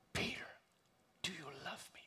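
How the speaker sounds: soft, intimate whisper; whispered question. Acted. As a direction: whisper it tenderly, leaving space after the name. Jesus asks Peter a second time, intimately.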